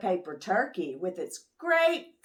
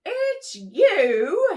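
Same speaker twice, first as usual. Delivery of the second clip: high, louder relieved squeak; porcupine voice; 11 semitones higher, 6 dB louder than usual. Acted. Porcupine relieved to see Moose, high squeak. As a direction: pop out and recognize friends.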